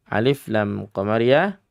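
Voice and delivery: ordinary delivery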